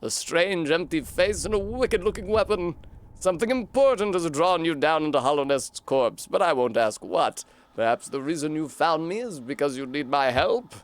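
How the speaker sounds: a kindly-sounding but scheming mystic offering a gift; British snail shaman. Acted. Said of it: "I'm British, apparently".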